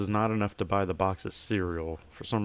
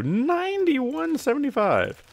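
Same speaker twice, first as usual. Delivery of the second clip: sing-song price call; 5 dB louder, slower than usual. Acted. Announcing the price in a voice.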